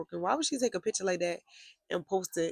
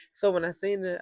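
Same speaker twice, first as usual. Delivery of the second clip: ordinary talk, nothing marked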